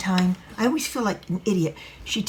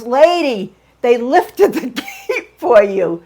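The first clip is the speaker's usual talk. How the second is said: mock driver; 4 semitones higher, 7 dB louder, slower than usual. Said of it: "opens his door and goes".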